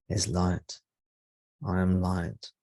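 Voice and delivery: usual speaking voice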